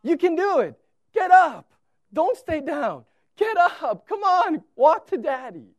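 put-on God cheering a fallen child back up; cheering Father. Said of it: "cheering you on, saying".

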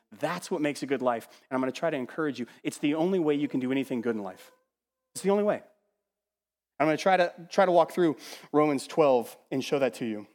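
usual speaking voice

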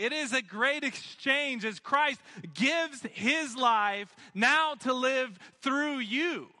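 high-pitched, building pulpit proclamation; fervent sermon voice, pitch lifted high; impassioned preaching. Real, not acted. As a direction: preach with rising fervour, stretching key phrases. Preaches the gift of Christ.

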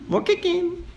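high-pitched toddler imitation; high baby-talk request; small child's voice. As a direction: pipe up like a hungry little kid. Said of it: "if she wants more chicken".

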